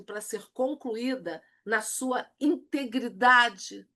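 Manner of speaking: ordinary delivery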